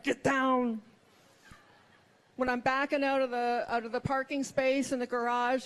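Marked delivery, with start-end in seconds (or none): 0.0-0.8 put-on Schwarzenegger-style action-movie command in Austrian accent; husband's hammed-up urgent Austrian accent. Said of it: "he says them so urgently".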